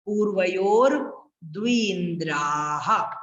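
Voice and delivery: slow पूर्वयोर, pause, stretched द्विन्द्राः; careful proper recitation. Shown as a demonstration. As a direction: draw out द्विन्द्राः and sound its final ह. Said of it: "अच्छे से बोलना है". (पूर्वयोर द्विन्द्राः धीरे, अच्छे से खींचकर)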